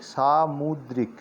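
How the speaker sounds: "सामुद्रिक" slowly broken into "saa-mud-rik"; syllable by syllable. Shown as a demonstration. Teacher sounds out सामुद्रिक for learners, slow and syllabled. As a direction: draw out each syllable of "सामुद्रिक".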